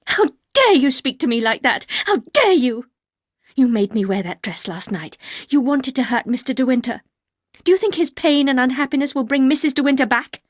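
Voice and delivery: wife turning on the housekeeper, loud and furious; angry outburst. Acted. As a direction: shake her with your words. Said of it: "suddenly very angry".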